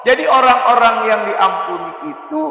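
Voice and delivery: loud, high-pitched exhortation; forceful preaching. Real, not acted. Preacher translating Allah's words for the congregation, forceful. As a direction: urge the congregation on at full voice.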